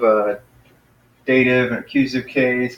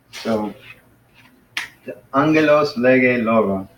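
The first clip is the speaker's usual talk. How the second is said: normal talking voice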